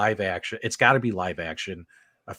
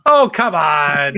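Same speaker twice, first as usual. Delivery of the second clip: drawn-out, higher and much louder protest; playful exasperated protest at a friend's teasing; mock outrage; 5 semitones higher, 10 dB louder, much slower than usual. Real, not acted. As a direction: groan it out slowly in disbelief. Reacts to a joke pick.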